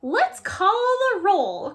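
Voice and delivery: high, loud crackling monster teacher. A narrator's reading. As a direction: crackle the command. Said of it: "crackles Missus Green".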